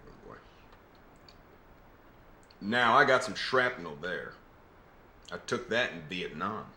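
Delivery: put-on Southern accent. Actor auditioning as a Southern clerk serving a ma'am, Southern accent. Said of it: "putting on a Southern accent".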